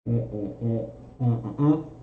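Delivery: savouring mm sounds. Real, not acted. Savouring the beer.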